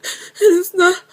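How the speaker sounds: high, slow, crying voice; tearful. Real, not acted. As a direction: struggle the words out through tears. Still crying over lost love.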